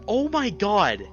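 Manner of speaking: usual speaking voice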